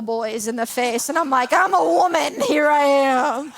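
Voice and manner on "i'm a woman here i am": drawn-out, hammed-up proud announcement; mock triumphant boast. Speaker tells church of beating boys at dodgeball, mock triumphant. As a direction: crow it slowly, playfully bragging.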